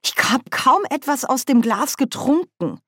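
high-pitched reproachful complaint; vorwurfsvoll. A narrator's reading. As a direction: protest over the lost drink. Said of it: "sagte Nanny vorwurfsvoll". (mit hoher Stimme vorwurfsvoll klagend)

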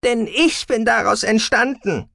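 high, loud, slow emphasis; angry, wounded declaration; impassioned outburst. A narrator's reading. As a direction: cry it out, drawing out the words. Daughter's outburst about her origin.

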